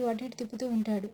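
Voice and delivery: plain reading voice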